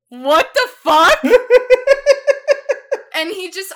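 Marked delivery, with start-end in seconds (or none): 0.1-1.2 loud, high disbelief broken by laughter; laughing what the fuck. Retold or imitated. Two kids in the light booth react to the teacher, loud laughing disbelief. 1.2-3.1 loud, high burst of laughter; real laughter. Real, not acted. After muting their mics, a loud high burst of laughter.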